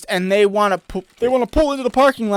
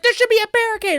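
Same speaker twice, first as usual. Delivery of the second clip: mock lady voice; faster than usual. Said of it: "She's like".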